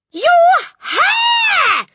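very high, piercing loud shriek; horrified shriek. A narrator's reading. Mother at husband's hair, horrified shriek. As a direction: shriek it in horror. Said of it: "the mother was shrieking".

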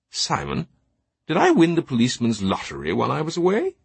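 high, puzzled Teabing voice, rising into the question; bewildered. A narrator's reading. Teabing greets Simon before a crowd of armed police, bewildered. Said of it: "He sounded more bewildered than concerned".

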